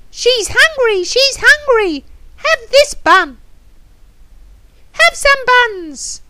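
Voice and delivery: high, loud squawky chatter; excited parrot. Acted. Parrot offering buns to hungry girl, excited parrot voice. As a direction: chant it high and pushy like a parrot.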